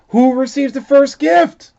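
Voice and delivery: put-on eager children's question; children asking eagerly. A narrator's reading. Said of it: "we all asked".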